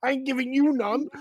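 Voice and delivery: high, loud bratty refusal; snatch it away, squeaky and spiteful; greedy goblin. Acted. Goblin refusing to share the page, high and loud.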